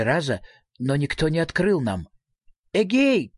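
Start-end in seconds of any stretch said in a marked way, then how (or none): 2.7-3.3 a mild call, pitch lifted; raised hail at a door. A narrator's reading. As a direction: call out to whoever is inside, without shouting. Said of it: "крикнул Фредриксон".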